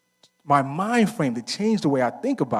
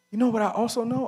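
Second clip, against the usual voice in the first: higher emphatic question; rhetorical build; 9 semitones higher, faster than usual. Real, not acted. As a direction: build the refrain. Rising rhetorical refrain.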